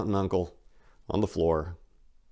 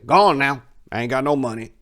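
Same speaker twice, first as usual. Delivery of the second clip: put-on loud, high brush-off of an old man; protesting old man; 6 semitones higher, 6 dB louder than usual. The old crackhead refusing Maria, protesting loudly. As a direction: wave her off, loud and high.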